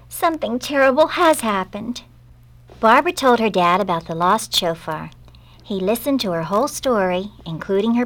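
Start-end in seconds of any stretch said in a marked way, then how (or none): 0.1-2.0 mildly acted tearful plea, higher in pitch; storybook distress without real sobs; sobbed. A narrator's reading. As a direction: break bad news as an upset child. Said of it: "sobbed Barbara".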